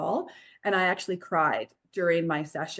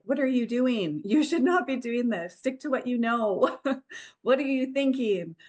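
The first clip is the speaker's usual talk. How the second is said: higher, mock-yelling inner critic; 6 semitones higher than usual. Doubting self scolding her new career choice, mock yell. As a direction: scold yourself like a panicked inner voice. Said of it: "it was yelling at me a lot".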